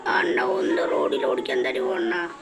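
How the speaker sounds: put-on soft, low boyish voice asking politely; a kid begging to drive; young Arjun. Young Arjun asks anna to drive, soft and pleading.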